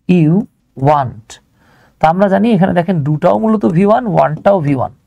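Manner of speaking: normal talking voice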